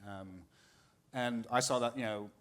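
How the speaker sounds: ordinary delivery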